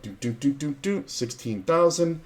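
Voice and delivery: mimed tallying, pitch climbing as it goes; re-enacted chip count.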